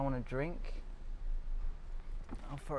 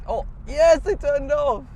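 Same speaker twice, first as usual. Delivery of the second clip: relieved; 11 semitones higher, 4 dB louder, slower than usual. Real, not acted. Relieved the police turned off.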